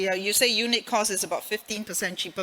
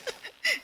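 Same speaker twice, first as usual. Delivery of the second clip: short laugh; 6 dB quieter than usual. Real, not acted. Laughs at mishearing.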